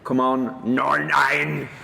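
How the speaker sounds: a light Northern Irish accent put on to tease; mock Northern Irish. Host teasing his co-host with a light Northern Irish accent. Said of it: "taking the piss out of my accent".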